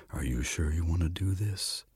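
hushed, careful question from Death; quiet last check; soft caution. A narrator's reading. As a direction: ask it gently, well under normal volume. Death checks before driving off.

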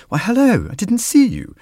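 very high, starchy greeting; starchy lady. Shown as a demonstration. Lady greeting Death in church, high and starchy. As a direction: ring it out high and proper. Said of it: "You could make her start you".

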